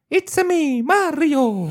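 very high, loud cartoon plumber voice; Mario. Shown as a demonstration. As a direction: squeal it out brightly like the video-game hero's catchphrase. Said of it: "tu peux nous faire une imitation". (voix de Mario suraiguë)

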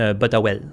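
«botte à well» said for bateau à voile, squeezed short; Magdalen Islands pronunciation. Shown as a demonstration. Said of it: "Au lieu de dire un bateau à voile".

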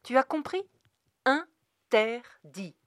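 loud, high demand, then a slow word; emphatic parental scolding; stern father. A narrator's reading. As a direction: drive the last word home syllable by syllable. Father scolds his upset son.

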